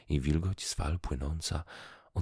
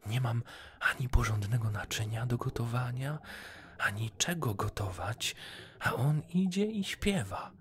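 higher-pitched, exasperated wife's complaint; grumbling to herself; 7 semitones higher than usual. A narrator's reading. Wife alone, fed up with her poor fisherman husband, grumbling. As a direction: lament your lot to yourself. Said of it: "mówiła do siebie żona".

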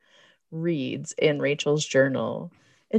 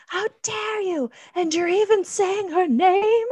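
high, melodramatic indignation; mock shocked mother, much higher; hammed-up outrage; 12 semitones higher than usual. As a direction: overplay the grieving mom's fury. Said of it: "she's like".

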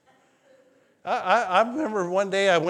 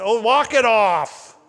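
slow, loud dismissive coach voice; gruff coach; 4 semitones higher, 7 dB louder, slower than usual. Retold or imitated. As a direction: bark it like an old football coach. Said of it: "the coach said".

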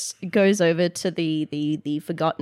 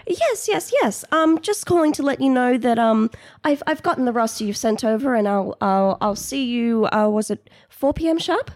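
higher, halting, eager-to-please voice; polite new employee, pitched up; nervous phone manner; 6 semitones higher, 5 dB louder than usual. Acted. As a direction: stumble politely through a call to the boss.

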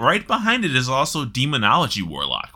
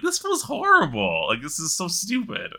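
high, whiny disbelief at a bad result; exasperated complaint; 7 semitones higher than usual. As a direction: complain like it's all pointless. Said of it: "and I'm like".

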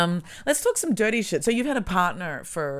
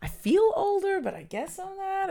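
higher mock musing; high inner voice; 14 semitones higher than usual. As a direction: say it in a higher, puzzled voice. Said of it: "and you're like".

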